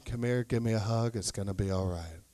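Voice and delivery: husband hugging his wife, very gently; tender comfort. Acted. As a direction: murmur it into a hug.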